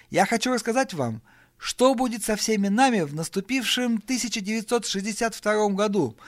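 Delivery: high, sustained announcing voice; speech to guests. Acted. Brian announces the year ahead to guests, high-pitched speechmaking. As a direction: proclaim it to the whole room.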